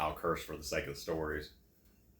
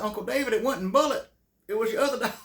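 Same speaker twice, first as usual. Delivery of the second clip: laughing outburst; 9 dB louder, faster than usual. Retold or imitated. Teller breaks bad news, laughing.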